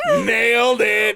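loud, high and slow gloating; triumphant shout. Real, not acted. Teen gloating to stunned friend, loud triumphant shout. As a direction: crow over the win. Proud after fooling friend.

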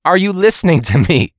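loud, high mock Ramsay yell; imitating Gordon Ramsay scolding; Gordon Ramsay impression. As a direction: bark it like an angry TV chef. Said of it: "Gordon Ramsay said".

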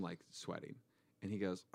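ordinary delivery

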